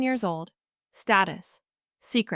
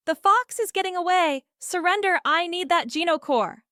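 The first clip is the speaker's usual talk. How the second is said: yelling; 7 semitones higher than usual. Acted.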